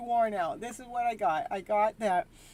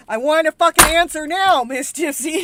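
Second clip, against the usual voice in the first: mock demanding viewer; 7 semitones higher, 17 dB louder than usual. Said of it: "and you guys".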